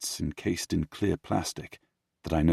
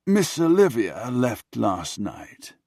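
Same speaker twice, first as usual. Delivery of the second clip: slow, higher-pitched butler voice; butler's reply; 5 semitones higher, 4 dB louder, slower than usual. Acted. Butler answering his angry master, slow pointed reply. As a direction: draw out the news in a raised voice.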